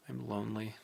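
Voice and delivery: very soft, distant voice; faint confession. A narrator's reading. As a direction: barely let it out. Whispered confession in dark.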